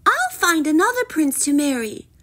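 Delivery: high, declaring princess voice; defiant princess. A narrator's reading. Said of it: "Poppy declared".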